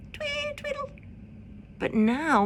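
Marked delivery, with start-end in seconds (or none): 0.1-0.9 very high, soft chirp of a chickadee; high chirpy birdsong. A narrator's reading. Narrator chirping the chickadee song, very high and soft. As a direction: squeak it out like a tiny bird. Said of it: "their song just sounded".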